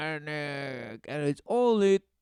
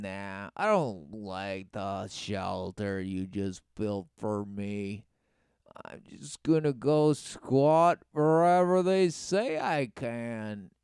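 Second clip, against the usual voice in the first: softer, lower lazy refusal impression; mocking homeless man; 8 semitones lower, 4 dB quieter than usual. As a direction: shrug it off lazily. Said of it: "the homeless are just gonna say".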